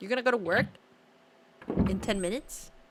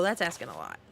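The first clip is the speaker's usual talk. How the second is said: low mock voice; 10 semitones lower, 4 dB quieter, faster than usual. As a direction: drop the voice way down. Deep mock remark.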